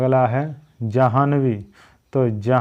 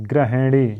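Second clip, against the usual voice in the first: usual speaking voice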